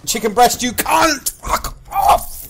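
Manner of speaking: high outburst, climbing to a screech; real rage, voice shooting up; enraged scream. Real, not acted. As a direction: scream abuse at your rival. Killed by a rival player.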